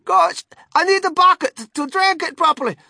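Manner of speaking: put-on high, loud boy's voice, greedy; excited high-pitched child. A narrator's reading. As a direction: gasp it out as an eager glutton. Greedy Augustus speaks in story.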